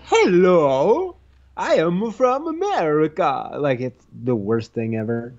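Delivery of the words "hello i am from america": high, hammed-up fake American accent; terrible American accent. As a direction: imitate someone badly faking an American accent. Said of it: "Could you just do an American accent?".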